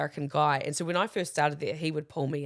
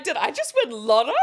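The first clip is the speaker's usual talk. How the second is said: higher, louder lotto joy; mock delight; 6 semitones higher, 6 dB louder than usual. Joking she'd celebrate missing a marathon, mock delight. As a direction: pretend you've hit the jackpot. Joke reply to bad news.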